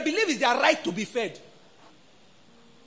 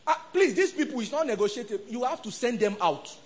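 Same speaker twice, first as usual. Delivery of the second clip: normal talking voice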